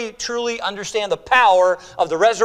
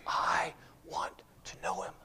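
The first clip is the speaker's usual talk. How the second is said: reverent whisper; hushed awe; 14 dB quieter than usual. Acted. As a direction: whisper it slowly, with longing. Awed repeat of Paul's longing.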